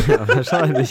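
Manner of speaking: laughing through the words. Real, not acted. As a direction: giggle it out. Laughing at the Seat joke.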